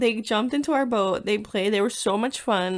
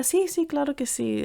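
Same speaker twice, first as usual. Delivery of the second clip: higher, eager boatman voice, retold; a light put-on voice for the boatman saying yes; imitating the boatman; 6 semitones higher than usual. Retelling how the boatman agreed to another hour, in a higher put-on voice.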